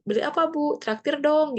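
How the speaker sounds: high-pitched casual wheedle; rayuan akrab. Acted. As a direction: playfully ask to be treated. Contoh obrolan informal dengan dosen. (bujukan santai bernada tinggi)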